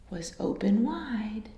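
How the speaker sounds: hushed, secretive whisper; suspense whisper. A narrator's reading. Narrator building suspense for children, whispered.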